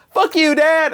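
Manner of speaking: high-pitched pretend child rebellion; mock bratty kid. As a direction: play a hammed-up kid rocker defying Dad. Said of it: "like the Disney Kids or like the Kids Incorporated".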